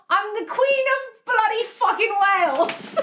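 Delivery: high, hammed-up bad British accent. Caller panicking on the phone, in a bad British accent. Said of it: "and I said".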